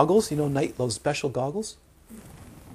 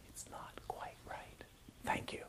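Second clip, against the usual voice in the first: hushed, slow whisper as a gentle critic; barely audible, breathy whisper; whispered quote; 18 dB quieter than usual. Acted. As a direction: whisper it under your breath. Imagined critic whispers feedback, quoted.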